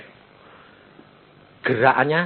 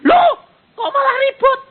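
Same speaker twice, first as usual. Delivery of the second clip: much higher, loud, laughing mock cry; mock alarm; 5 dB louder than usual. Pastor mimics someone panicking that it got noisier, laughing and high. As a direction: ham up the panic while laughing.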